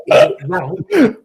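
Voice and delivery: high, stretched laughing question; laughing at the kits; laughing squeal. Real, not acted. Joking about ranking bad kits.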